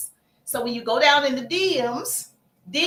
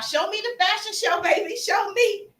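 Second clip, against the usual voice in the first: much higher, gushing and eager; eager interest; 8 semitones higher than usual. Retold or imitated. Seller to a customer's fashion show, high eager gush. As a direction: show real excitement about her business. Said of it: "Because I'm interested".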